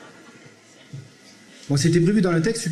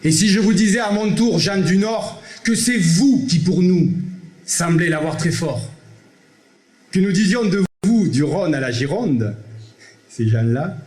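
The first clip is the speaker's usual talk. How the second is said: poem recital; 7 semitones lower than usual. Acted. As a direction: declaim it like verse on a stage. Recites Zamacoïs poem over music.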